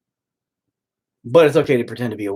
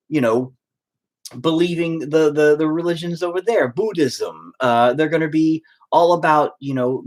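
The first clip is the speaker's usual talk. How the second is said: ordinary delivery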